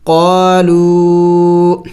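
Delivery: قالو with the و held long; very slow, stretch قالو; قالو, the long vowel drawn out beyond one beat; lengthened vowel. Shown as a demonstration. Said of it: "تھوڑا سا اور بھی زیادہ".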